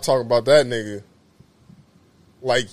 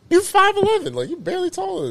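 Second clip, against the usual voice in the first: excited. Real, not acted. Teasing height claim.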